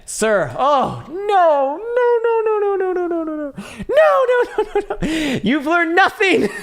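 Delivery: very high, loud squealed protest; over-the-top falsetto outburst; mock outraged scolding. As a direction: wail it in hammed-up despair, breaking into laughter. Mock scolding of viewer Kevin.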